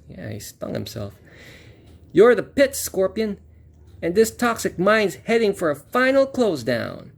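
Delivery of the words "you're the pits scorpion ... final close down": put-on high, cocky kid hero. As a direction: taunt him as a brash young hero. Hero lines from the comic.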